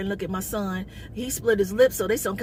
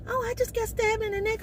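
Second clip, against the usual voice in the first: nonchalant; 13 semitones higher than usual. Retold or imitated. Mimicking the stabbed man's nonchalance, high and casual. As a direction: toss it off high as if it's nothing. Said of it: "like, nonchalant".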